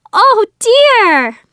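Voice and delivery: high, loud alarm; dismayed cry. A narrator's reading. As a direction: wail it in dismay, high then falling. Nieces dread being caught.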